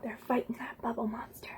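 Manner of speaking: soft unvoiced story whisper. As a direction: hush it like a secret. Tense storytime moment.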